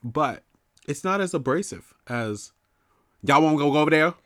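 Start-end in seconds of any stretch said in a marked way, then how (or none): 3.2-4.1 loud mock-Southern hollering; yelled country accent. Mocking loud locals going over there, yelled Southern accent. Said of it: "Who are you yelling at".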